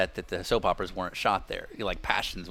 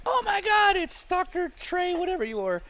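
high, squealing starstruck fan; starstruck fangirl; 3 dB louder than usual. Retold or imitated. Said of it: "random girls who stop and like".

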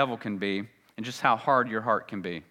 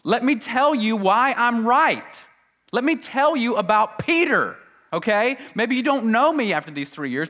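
higher and louder, insistent Peter; emphatic protest; 11 semitones higher, 8 dB louder than usual. Acted. Peter insisting to Jesus he is right, loudly emphatic. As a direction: Peter overriding his teacher with certainty. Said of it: "But Peter said emphatically".

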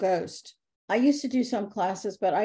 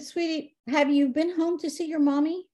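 put-on higher, tender voice for a child; gentle coaxing; 7 semitones higher than usual. Medium gently asking the ghost girl about home, tender.

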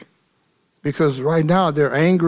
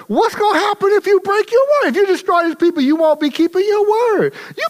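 higher, louder, driving preacher's cadence; preaching at full force; 3 dB louder than usual. Acted. Pastor urging his church on how to answer anger, full force. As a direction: lift the congregation with rising fire.

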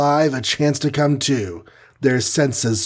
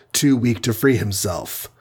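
ordinary delivery

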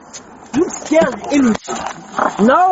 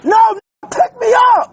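shouting; 7 semitones higher than usual. Real, not acted. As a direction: yell it at them. Shouting demand at officers.

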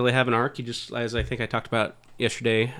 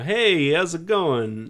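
forties movie guy; 5 semitones higher, 4 dB louder, much slower than usual. Retold or imitated. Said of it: "into that kind of forties".

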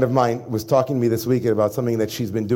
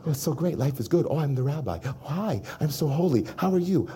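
quiet, mock-saintly public greeting; a hint of a self-satisfied rabbi; mock holy rabbi; 6 dB quieter than usual. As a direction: preen softly as the smug holy rabbi. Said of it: "when I'm performing for the world".

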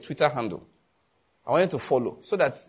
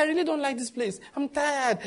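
mock weary aunt, higher and softer; imitating auntie; 9 semitones higher, 3 dB quieter than usual. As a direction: sigh it out like the tired auntie. Pastor voices aunt complaining.

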